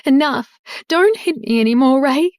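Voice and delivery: high, loud cry of pain. A narrator's reading. Wife shielding her daughter, high loud cry to stop him. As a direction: stop him from striking again. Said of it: "Cindy cried".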